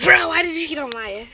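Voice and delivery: loud teasing call. Real, not acted. Girl loudly teasing Ronil about Maya. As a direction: shout the question at him.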